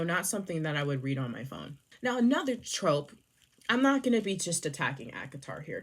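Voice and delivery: ordinary talk, nothing marked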